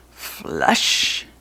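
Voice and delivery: deep whooshing flush noise; flush sound effect. A narrator's reading. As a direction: make the toilet flush. Toilet swallows teacher.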